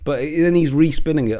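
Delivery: normal talking voice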